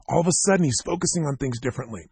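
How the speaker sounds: ordinary talk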